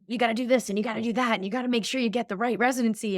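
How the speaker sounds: rushed, anxious rattling of must-dos; mock anxious nagging. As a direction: rattle it off like panicking classmates. Peers anxiously nagging about residencies.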